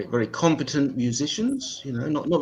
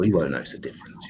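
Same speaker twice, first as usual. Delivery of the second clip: quick conspiratorial whisper; hushed, fast plotting whisper; whispered aside; 9 semitones lower, faster than usual. Retold or imitated. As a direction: whisper it as a guilty secret. Sneaky thought about the basil.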